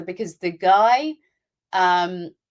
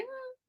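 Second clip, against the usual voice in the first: a high, tight disapproving hmm; unimpressed hmm; 19 dB quieter than usual. Retold or imitated. Watching gory horror scene, unconvinced high hmm. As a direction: hum it like you're not convinced. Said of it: "I was just like".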